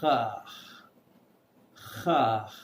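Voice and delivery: H as a rasping throat sound; French R demo. Shown as a demonstration. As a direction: make the H from the throat like a cough. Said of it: "It is a throat sound".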